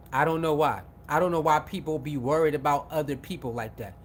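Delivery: ordinary talk, nothing marked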